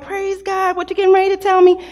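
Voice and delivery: bright, eager "tell me"; eager excitement. Retold or imitated. Wife eager for husband's news.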